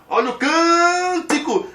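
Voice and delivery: high, loud sports commentator cry, first syllable stretched; Galvão Bueno. Acted. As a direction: belt it out like a goal call. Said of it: "Imagine que o Galvão Bueno vai falar". (narrador esportivo, sílaba tônica esticada)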